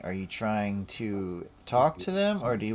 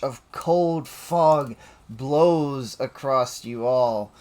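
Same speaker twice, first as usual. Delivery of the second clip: dramatic scene narration; 4 semitones higher, 7 dB louder than usual. Acted. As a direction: paint the icy blast with a swell. Game master describes the breath attack.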